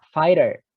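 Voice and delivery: "fighter" said as faiter, the gh silent; the gh in "fighter" dropped. Shown as a demonstration. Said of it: "G-nya tidak dibaca".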